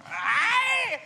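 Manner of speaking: mock yelp. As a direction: yelp as if jumping back. Pinky startled by disease talk.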